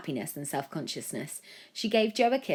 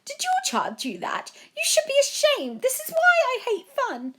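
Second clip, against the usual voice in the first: much higher, louder hysterical rant; furious parent; 7 dB louder than usual. A narrator's reading. Angry parent accusing.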